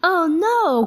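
high dismayed oh no; cried in dismay. A narrator's reading. Buzz hearing every fly say his name, high dismayed cry. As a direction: let it jump up in alarm. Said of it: "cried Buzz".

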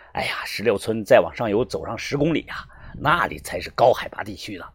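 low, whispery male character voice; innkeeper character. A narrator's reading. Guesthouse owner to the guest who scared him, low whispery voice. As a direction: voice a shaken host, low and husky-soft. Innkeeper Lao Zhang speaks.

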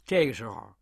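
plain reading voice

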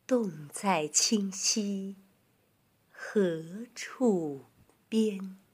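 very slow, measured recital; poetry recitation. Shown as a demonstration. Teacher reciting a Tang poem for children, slow recitation. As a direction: stretch each phrase with long pauses.

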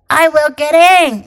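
loud big bad wolf. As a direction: insist like the wolf. Wolf insisting.

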